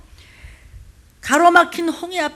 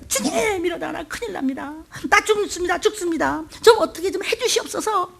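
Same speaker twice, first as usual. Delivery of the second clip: high pleading cry; mock panic; 6 semitones higher than usual. Believer begging the Lord in trouble, high mock panic. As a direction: beg in a panic.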